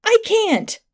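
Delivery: high, desperate cry; panicked refusal. A narrator's reading. Attacker unable to stop stabbing, high panicked cry. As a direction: pitch it way up, helpless.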